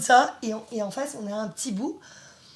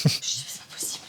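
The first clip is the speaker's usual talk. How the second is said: whispered, much lower, in shocked disbelief; disbelieving whisper; 11 semitones lower, much faster than usual. Retold or imitated. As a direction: breathe it out as if you can't believe it. Retold disbelief at boy's voice. (chuchoté, incrédule)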